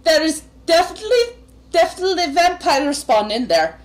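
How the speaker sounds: high, urgent report to a superior; alarmed guard. Acted. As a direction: play a rattled guard reporting danger.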